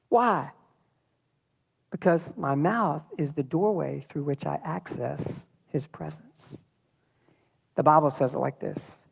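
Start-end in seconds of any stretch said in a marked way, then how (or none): 1.9-6.6 slow, dropping to a whisper on his presence; hushed emphasis. Real, not acted. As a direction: lean in and breathe the last words. Preacher drops voice on key point.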